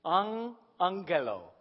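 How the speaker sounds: "An angelo" drawn out slowly; slow, deliberate "An angelo"; sounded out slowly. Shown as a demonstration. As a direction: stretch "An angelo" so the hidden word can be heard. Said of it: "You hear a word in there".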